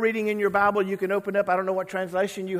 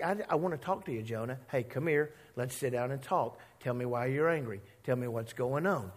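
gentle coaxing God; 5 semitones lower, 5 dB quieter than usual. Retold or imitated. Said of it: "God's like".

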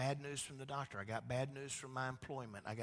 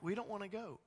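put-on higher, childish whine; mock-whiny Israelites refusing God; whiny refusal; 7 semitones higher, faster than usual. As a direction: sulk like a kid refusing. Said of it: "And they're like".

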